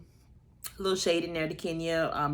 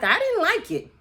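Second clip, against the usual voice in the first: quoting Ralph, pitched way up and louder; mock high retort; 13 semitones higher, 6 dB louder than usual. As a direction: toss off his answer high and loud. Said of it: "He was like".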